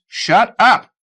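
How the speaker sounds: put-on furious yell at the old man; angry shout. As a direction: shout it, high and loud.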